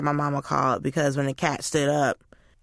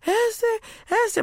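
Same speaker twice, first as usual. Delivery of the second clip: high, wailed calling-out; hammed-up high-pitched distressed call; mock bawling. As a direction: imitate tearfully calling for a lost cat. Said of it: "And I was bawling".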